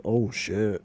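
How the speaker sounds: low, soft pretend discovery of a new voice; mock deep-voiced realization. Asking if his voice changed overnight, mock low voice. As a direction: play waking up with a suddenly deep voice. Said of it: "you woke up one morning and you were just like".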